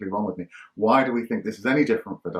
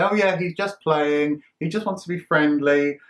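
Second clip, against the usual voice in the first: airy, higher, slow brush-off; dismissive dog owner; 6 semitones higher, 4 dB louder than usual. Retold or imitated. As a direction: wave off the worry like a breezy stranger. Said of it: "The stranger will almost certainly say".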